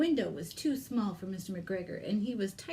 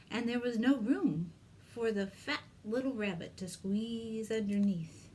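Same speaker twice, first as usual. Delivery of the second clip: plain reading voice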